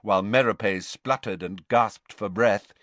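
usual speaking voice

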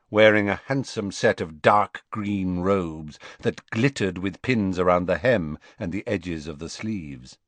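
usual speaking voice

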